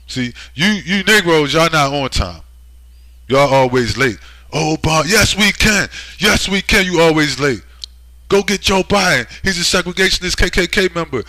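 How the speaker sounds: high, loud mocking cheer for Obama fans; shrill-free loud mock rally chant; mock hype voice. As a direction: ham it up like a rally crowd. Mocking excited Obama-era crowd.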